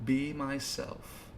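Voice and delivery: slow, very soft reply; hushed. Acted. Wolf turned human answering the player, hushed. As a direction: say it slowly, barely voiced.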